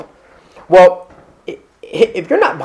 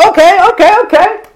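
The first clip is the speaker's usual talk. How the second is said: much higher, hurried mock panic; 13 semitones higher, 4 dB louder than usual. Drowning person clutching at the helper, mock panic. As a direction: grab on in a hammed-up panic. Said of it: "And they're like".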